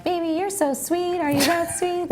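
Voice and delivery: high crooning pickup line voice; sleazy guy hitting on her. Retold or imitated. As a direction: pitch it up and croon like a creep. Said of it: "them guys hitting on her, like".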